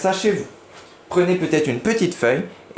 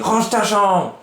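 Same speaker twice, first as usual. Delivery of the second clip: higher, louder bossy command; shouted order; 7 semitones higher, 4 dB louder, slower than usual. Shown as a demonstration. Parent ordering the room tidied, shouting. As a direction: yell the order at the child. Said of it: "qui crie".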